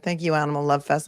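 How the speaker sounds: normal talking voice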